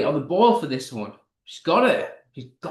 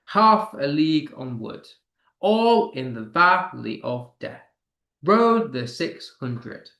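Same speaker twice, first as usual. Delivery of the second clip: measured beats, leaning on "Half", "All" and "Rode"; emphasized poetic meter. Shown as a demonstration. Teacher scanning a poem aloud, first beat of each phrase stressed. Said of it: "The emphasis on the half".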